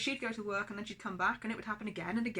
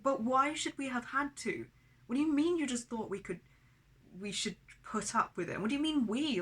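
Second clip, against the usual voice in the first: raised, heated voice demanding answers; angry confrontation; 5 semitones higher, 5 dB louder than usual. Retold or imitated. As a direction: fire the questions back at her, rising anger. Confronting mum, angry quote.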